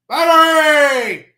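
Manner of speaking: booming, drawn-out parade-ground command; drill sergeant voice. Acted. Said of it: "I don't have my drill sergeant voice".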